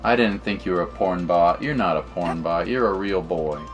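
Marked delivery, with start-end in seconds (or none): none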